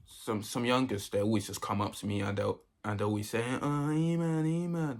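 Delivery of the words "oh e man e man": high, loud, drawn-out mock fan call; glazing youngsters. Youngsters run up to glaze him, high mock fawning. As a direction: imitate kids fawning over their idol. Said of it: "They always start glazing me".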